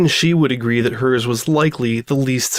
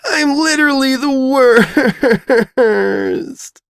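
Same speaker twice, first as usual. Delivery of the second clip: high, broken cry, long pause before worst; sob, stall, then squeeze out worst; sobbing; 12 semitones higher, 4 dB louder than usual. Acted. Weeping girl declares herself the worst, sobbing. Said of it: "her crying intensified".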